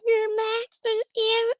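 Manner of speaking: put-on very high small child's voice; toddler voice. Grandson asking grandma if she is mad, tiny high child voice. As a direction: squeak it like a puzzled toddler. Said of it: "And he's like".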